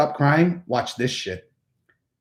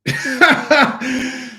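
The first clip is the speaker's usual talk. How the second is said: high burst of laughter; laughing; 11 semitones higher than usual. Real, not acted. Amused at the video of himself, high laugh.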